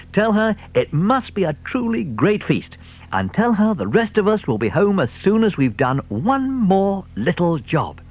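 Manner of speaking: high, fatherly fox instructions. Acted. Mister Fox to his sons, high fatherly voice. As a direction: send them off kindly.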